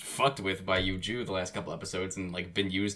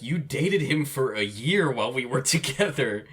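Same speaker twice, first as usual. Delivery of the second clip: accusing; 6 semitones higher, 4 dB louder than usual. Retold or imitated. Acted quote from a heated breakup argument.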